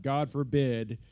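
usual speaking voice

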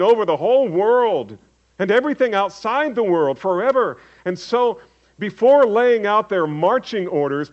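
sermon build from calm to full-voiced proclamation; preacher lifting his voice toward the climax; preaching. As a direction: proclaim it to the congregation. Preaching with rising force.

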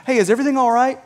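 lightly voiced boss checking on an employee; casual boss check-in. Boss coming to a worker, casual check-in. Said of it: "not the same as your boss coming to you".

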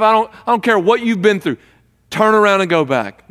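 a hint of an angry father yelling, loud and high; hammed-up father shouting his son away; mock angry father. Father yelling at the far-off prodigal son, loud mock rejection. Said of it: "did the dad yell back and say".

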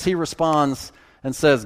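normal talking voice

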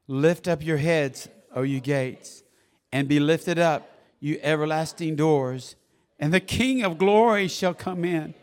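measured, loud psalm reading; scripture proclamation. Real, not acted. Preacher has congregation read verse seven along, proclaiming. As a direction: read it out firmly for all to follow.

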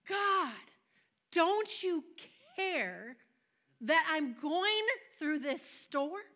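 loud, high, drawn-out pleading; desperate cry to God. Acted. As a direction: call out to God as if abandoned in a storm. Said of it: "That's how they felt".

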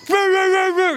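high loud imitated barking; dog barking. Pastor imitating the dog charging him. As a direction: bark like a charging German Shepherd.

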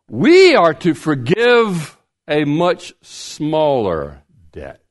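slow it right down to drive the point home; slow emphasis. Real, not acted. Preacher to congregation, slow and emphatic. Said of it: "listen now".

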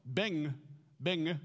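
Beng said with the ng like in long; Beng, ending on the back-tongue ng; saying Beng. Shown as a demonstration. Pastor teaching the name Beng, ng sound. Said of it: "His name was B E N G".

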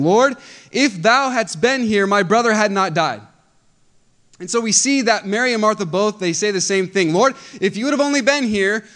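loud, high scripture reading with fervor; full-force preaching. Acted. Preacher reading Mary's plea to the congregation, fervent and loud. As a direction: proclaim the grieving sisters' words to the congregation.

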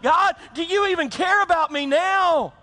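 high, strained cry at God; anguished demand. Acted. Acting out angry demand to God.

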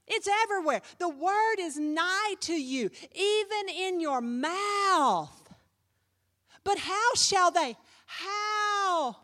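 full-force preaching. Acted. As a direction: preach it out to the congregation.

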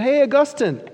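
high, loud call across the street. Retold or imitated. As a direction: call his name to stop him. Old friend calls out on street.

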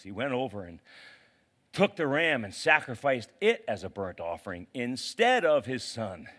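ordinary delivery

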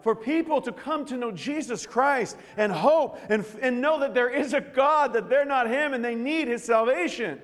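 loud, high impassioned preaching; full-force preaching. Acted. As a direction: pour your heart out to the congregation, full voice.

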